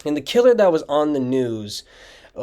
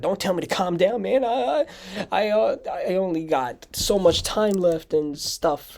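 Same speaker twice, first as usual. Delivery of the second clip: put-on panicking kid; 7 semitones higher than usual. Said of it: "he was panicking a lot".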